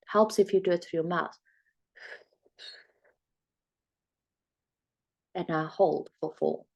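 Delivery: slow, measured counting with long pauses between; guided breathing count. Shown as a demonstration. Coach guiding viewers through box breathing, slow and calm. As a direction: talk them through each breath calmly. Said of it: "So it looks like this".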